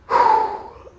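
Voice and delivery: high, breathy sigh of disbelief; exasperated sigh. Real, not acted. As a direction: sigh like you can't believe it. Baffled by the [name] news.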